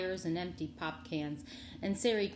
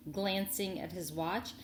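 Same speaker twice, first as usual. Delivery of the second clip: ordinary delivery, nothing marked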